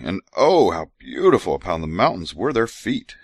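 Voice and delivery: measured scripture recital; scripture reading. Acted. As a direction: read it like holy writ. Reads scripture aloud.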